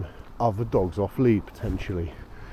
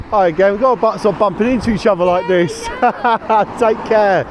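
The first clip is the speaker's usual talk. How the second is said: higher and louder, bright friendly hello; cheery greeting; 9 semitones higher, 6 dB louder than usual. Real, not acted. Bumping into the same dog walker again, cheery and loud. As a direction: call out a jokey hello to a neighbour. Greeting lady met earlier.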